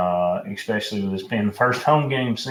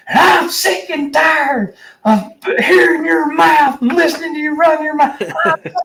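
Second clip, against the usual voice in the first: much higher, louder mock scream, slowed down; angry caller screaming at the radio host, in a much higher mock scream; 7 dB louder than usual. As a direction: impersonate a caller losing it on air. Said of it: "dude is screaming at the top of his lungs".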